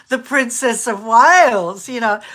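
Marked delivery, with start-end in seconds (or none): none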